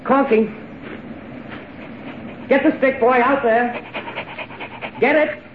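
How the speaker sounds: calling out to a dog far off. Acted. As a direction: shout for Corky to fetch the stick.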